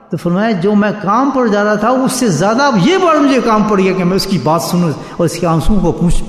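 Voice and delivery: normal talking voice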